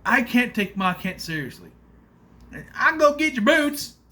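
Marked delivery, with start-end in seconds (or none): 2.7-4.0 high, loud mock country Kansas accent; stupid Kansas accent. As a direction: put on a silly, exaggerated Kansas accent. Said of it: "ever had a stupid accent".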